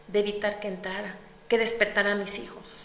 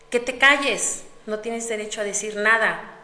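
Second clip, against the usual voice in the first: angry command; 6 dB louder than usual. Acted. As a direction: shut her up with rage. Marido le ordena callar. (orden furiosa del marido)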